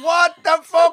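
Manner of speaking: high, loud bewildered yell; panicked shout. Retold or imitated. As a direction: shout it, totally lost. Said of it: "I'm there going".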